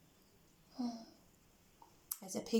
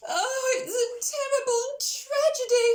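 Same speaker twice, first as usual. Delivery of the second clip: much higher, louder, crying Pig; Pig wailing; 13 semitones higher, 6 dB louder than usual. Acted. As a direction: wail like a dramatic little pig. Pig despairs.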